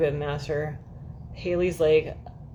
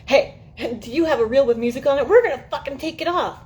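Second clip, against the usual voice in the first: mock Facebook message; 8 semitones higher, 6 dB louder than usual. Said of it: "it goes".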